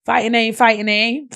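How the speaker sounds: high, loud call across the yard; cousins calling out. Retold or imitated. Cousins call him from the backyard ring, a high loud shout. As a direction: yell for him like a kid calling a friend out to play. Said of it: "they used to call me like".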